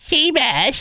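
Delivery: put-on high, horny old woman voice; Seabass's grandma. Host as co-host's grandmother calling him, old-granny voice. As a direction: croon it like a lewd old granny. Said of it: "Seabass's horny grandmother voice".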